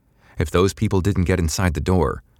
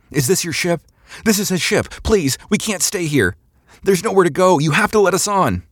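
very high, frantic begging; desperate pleas; 14 semitones higher, 3 dB louder than usual. A narrator's reading. Stranded crowd begs to board his ship, desperate pleading. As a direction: plead in panic, voice pitched way up. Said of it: "the pleas of the crowd".

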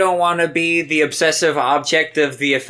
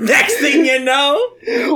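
laughing through the words; 7 semitones higher, slower than usual. Real, not acted. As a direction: crack up mid-line. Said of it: "crying and laughing".

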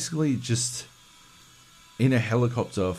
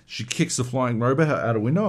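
ordinary talk, nothing marked

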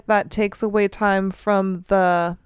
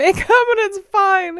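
laughing; 13 semitones higher, 4 dB louder than usual. Real, not acted. Laughs mid-sentence.

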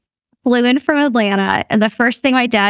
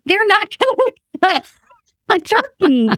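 panicked disbelief; 9 semitones higher than usual. Retold or imitated. Parents panicking over frozen turkey.

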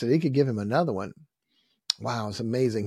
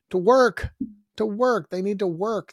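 emphatic preaching shout; 8 semitones higher, 5 dB louder than usual. Real, not acted. Preacher pounding a point.

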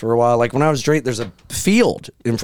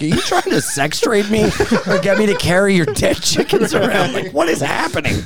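laughing disbelief, pitched up; half-laughing at the absurd offer; incredulous laughing; 9 semitones higher than usual. Retold or imitated. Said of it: "I'm like".

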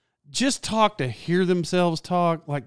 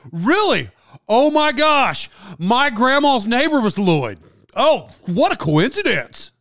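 higher, drawn-out fake amazement; mock-excited gushing; 5 semitones higher than usual. Chatty guy tells Lloyd about grandma's neighbor, mock-excited. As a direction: imitate an overeager talker thrilled by small talk.

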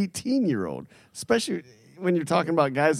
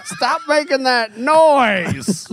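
put-on slow, louder grumpy old man; old prospector; 8 semitones higher, 6 dB louder, much slower than usual. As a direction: scold like an old codger. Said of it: "get off my lawn".

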